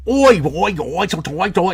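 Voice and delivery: loud, high mock Cockney oi; a bad British accent impression. Shown as a demonstration. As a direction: bark oi like a caricature Brit. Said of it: "that wasn't very good".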